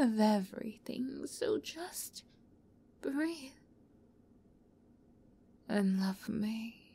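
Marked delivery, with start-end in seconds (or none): none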